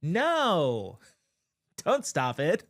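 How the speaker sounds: a half-laughing mock of the governor refusing; Tim Walz impression. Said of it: "let me do my Tim Walz voice".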